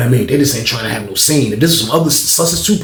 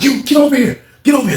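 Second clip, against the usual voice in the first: mock officer yelling; 11 semitones higher, 4 dB louder than usual. Officer calls inmate again.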